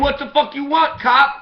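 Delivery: hammed-up street challenge; aggressive shout. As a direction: yell it at the cop in the street. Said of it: "I'm saying".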